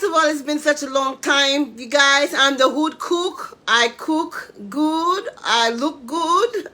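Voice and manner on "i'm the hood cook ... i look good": slow, drawn-out sing-song catchphrase; proud slow signature greeting. Acted. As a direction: savour each rhyme like a show intro.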